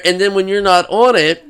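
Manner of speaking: ordinary talk, nothing marked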